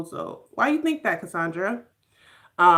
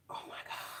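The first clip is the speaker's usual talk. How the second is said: breathless, stunned whisper under the breath; shocked whisper; 22 dB quieter, much faster than usual. Real, not acted. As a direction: let it slip out as a whisper of disbelief. Shocked reaction to heartbreaking scene.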